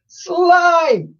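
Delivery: very high shout. A narrator's reading. Boy shouting for Slime, very high shout. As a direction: call it up to the roof. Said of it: "shouted the boy again".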